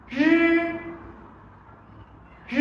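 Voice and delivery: "Rư" with the tongue curled and no vibration, unlike Vietnamese r; model syllable. Shown as a demonstration. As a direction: demonstrate "Rư" twice for repetition. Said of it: "chúng ta sẽ cùng đọc nhé". (đọc mẫu "Rư" không rung)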